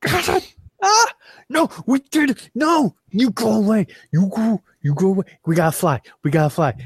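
high, crying and desperate; terrified crying. Acted. Chip terrified of the giant baby head, high crying panic. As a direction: plead as if the monster is there.

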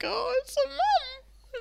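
high, slow tearful wail; choked-up sob. Retold or imitated. Host retelling the finale, wailing high and slow. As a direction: let the words break into a sob.

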